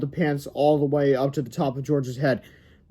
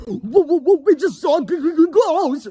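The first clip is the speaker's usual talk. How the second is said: much higher, louder stammering fright; mock terrified cry; 13 semitones higher, 6 dB louder than usual. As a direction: fake panic about ghosts. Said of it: "cried George".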